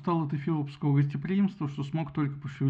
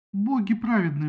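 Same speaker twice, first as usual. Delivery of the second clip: громкий высокий возглас; всплесни руками голосом; возмущённо; 5 semitones higher, 6 dB louder than usual. A narrator's reading. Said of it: "возмутилась Гера". (возмущённо вскрикнула)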